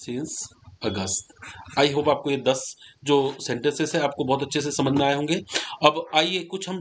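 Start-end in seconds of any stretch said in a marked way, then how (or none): 0.0-1.3 since August spoken very slowly and softly; slow dictation. Shown as a demonstration. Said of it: "तो क्या कर देंगे हम इसमें?". (since अगस्त धीरे-धीरे, बोलकर लिखवाते हुए)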